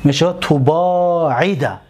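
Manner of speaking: تباعد drawn out with clear vowels; slow dictation; slow model word. Shown as a demonstration.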